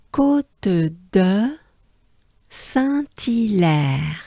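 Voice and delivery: "Côte de Saint-Hilaire" slowly, syllable by syllable; koht-duh, pause, san-tee-lèr for "Côte de Saint-Hilaire"; French pronunciation demo. Shown as a demonstration. Teacher leading learners in unison, slow syllabled French. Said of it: "let's do it together ensemble".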